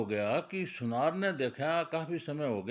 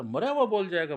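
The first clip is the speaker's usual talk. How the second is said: higher, louder disbelieving question; incredulous; 5 semitones higher, 6 dB louder than usual. Acted. Sons question the man's claim, loud and incredulous. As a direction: throw it back in astonishment.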